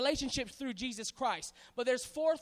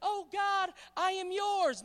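mock newborn prayer; 5 semitones higher, slower than usual. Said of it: "You didn't come into this world saying".

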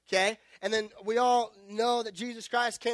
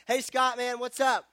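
higher, louder breezy greeting from an unknown caller; casual caller; 6 semitones higher, 8 dB louder than usual. Retold or imitated. Unknown caller greeting the pastor, breezy and loud. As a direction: hail him like an old buddy. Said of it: "calling me and be like".

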